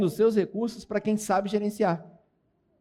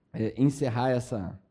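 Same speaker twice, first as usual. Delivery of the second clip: trailing off; 6 semitones lower, 4 dB quieter than usual. Real, not acted. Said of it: "Já não sei mais o que eu ia falar".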